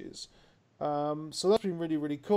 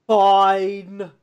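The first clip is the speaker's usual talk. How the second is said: loud, grudging "Fine"; reluctant fine; 5 semitones higher, 14 dB louder than usual. Retold or imitated. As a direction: give in with an exasperated bark. Grudging reply to friend.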